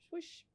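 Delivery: soft, high swish noise; imitated swish. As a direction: make a quiet whooshing exit sound. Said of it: "my assistant manager just went".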